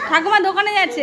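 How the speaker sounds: loud and high calling out; to someone hard of hearing. Real, not acted. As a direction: call it out loudly to grandma. Calling out across the house.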